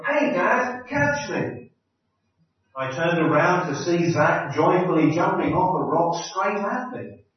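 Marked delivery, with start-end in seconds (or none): 0.0-1.7 high, excited call from above; mock call of a boy about to jump; child's yell. Son calling down to his dad from a rock, high excited yell. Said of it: "I heard a voice from above me yell".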